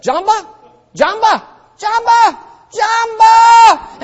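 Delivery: high, building street call, ending in a shout. Retold or imitated. Pastor outside Jamba Juice calling to passersby, rising to a shout.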